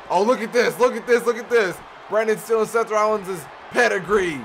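high, loud hyped yell; excited shout. Real, not acted. Commentator hyping Brandon's stolen pedigree, shouting high and loud. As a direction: scream it like the crowd just exploded.

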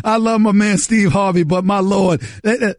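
ordinary talk, nothing marked

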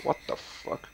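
quiet breathy disbelief; hushed shock. Real, not acted. Gamer seeing odd animation, hushed disbelief. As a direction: murmur it in shock.

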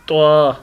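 त्वा spoken heavy, tongue tip at the root of the upper teeth; heavy letter demo. Shown as a demonstration. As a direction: model त्वा clearly for pupils. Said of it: "पढ़ के देख लें".

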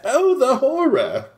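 slow, high theatrical mock wail; high-pitched, stretched-out mock dread; imitated melodrama. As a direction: copy the hammed-up horror line, drawn out. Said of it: "you gotta say it like that".

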